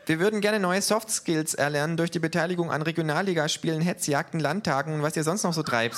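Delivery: high, quick, mocking kangaroo voice; cheeky kangaroo. The kangaroo teases the neo-Nazis, high and cheeky. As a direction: needle the thugs with fake innocence.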